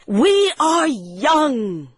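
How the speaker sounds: high, drawn-out exultant cry; joyful cry. Acted. Guests at the happy height of youth, high joyful cry. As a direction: draw it out slowly, high and elated. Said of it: "they cried".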